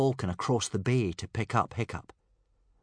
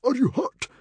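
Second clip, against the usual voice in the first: much higher, worried ask; a father's anxious check; urgent, higher worried question; 6 dB louder than usual. A narrator's reading. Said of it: "asked Stoic anxiously".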